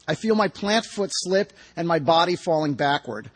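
ordinary delivery

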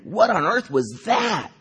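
high, drawn-out cry of disbelief; shocked outburst. Acted. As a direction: exclaim it high and slow, aghast. Startled outburst inside the story.

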